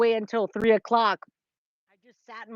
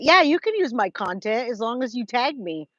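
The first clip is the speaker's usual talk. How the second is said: ordinary talk, nothing marked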